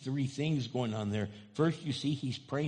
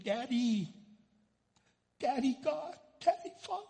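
put-on higher, rising childlike cry of Daddy; pleading to Daddy; 11 semitones higher than usual. Preacher voicing Jesus crying Daddy to God. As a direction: call out like a child to his father. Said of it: "That's literally saying".

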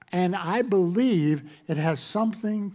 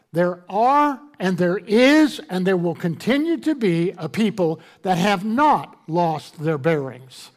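rising preacher's call, louder on the key lines; full-force preaching; 5 dB louder than usual. Acted. Preacher to congregation, loud rousing sermon delivery.

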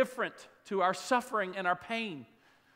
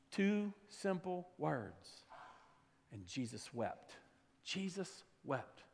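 very soft, low, slow hush; almost whisper the verse in awe; hushed reverence; 6 semitones lower, 12 dB quieter, slower than usual. Acted. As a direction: quietly dwell on the shortest verse.

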